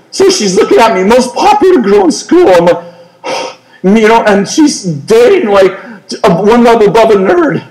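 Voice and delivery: normal talking voice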